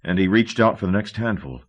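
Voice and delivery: plain reading voice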